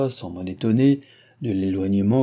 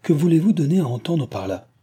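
indignant question; 7 semitones higher, 4 dB louder than usual. A narrator's reading. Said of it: "s'écria Cécile".

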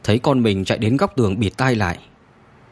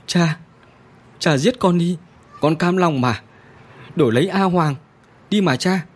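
crying plea; 6 semitones higher than usual. A narrator's reading. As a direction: beg through tears, offering himself. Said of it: "Người thiếu niên khóc lóc". (van xin trong nước mắt, giọng cao và to hơn)

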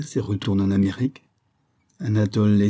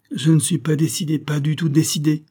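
higher voice in agitated bursts; jerky, halting; 7 semitones higher than usual. A narrator's reading. Anatole confesses his indecision to the colonel, jerky and agitated. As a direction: break it up jerkily, pitched up. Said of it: "continua Anatole d'une voix saccadée".

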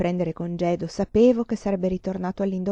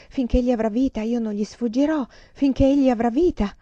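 plain reading voice

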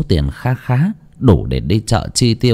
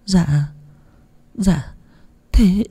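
stammering; 10 semitones higher than usual. A narrator's reading. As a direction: stutter timidly after being scolded. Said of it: "ấp a ấp úng".

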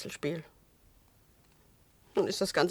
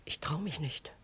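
faint, much softer fearful whisper; scared whisper; 3 semitones lower, 9 dB quieter than usual. Acted. Whispering fear to a partner urging a step outside. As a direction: barely dare to say it.

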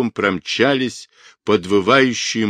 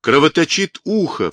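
mildly raised exclamation, pitch leaping on the second phrase; understated alarmed cry; alarmed shout; 6 semitones higher than usual. A narrator's reading. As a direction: cry it out urgently without much volume. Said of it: "в самое лицо ей крикнул он".

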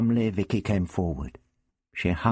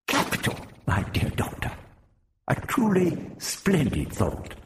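Prapillus, delighted; 11 semitones higher than usual. A narrator's reading. Prapillus applauds the Doctor's plan, delightedly. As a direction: savour the praise with beaming pleasure. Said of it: "Prapillus beamed delightedly".

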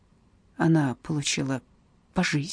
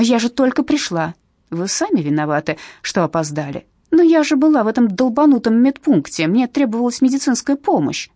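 indignant protest; 5 semitones higher, 5 dB louder than usual. Acted. Mother protesting to guards.

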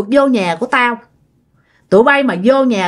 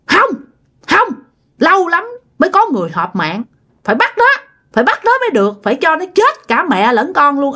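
higher, insistent ghost voice; stubborn male ghost; 5 semitones higher than usual. Acted. Male ghost refuses the grandfather, insisting harshly.

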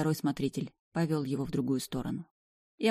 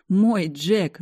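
ordinary delivery

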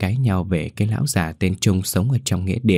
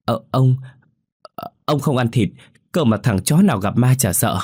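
nervous stammer; 5 semitones higher than usual. A narrator's reading. Scared student stammers back.

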